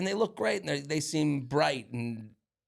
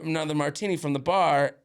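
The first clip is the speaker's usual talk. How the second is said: usual speaking voice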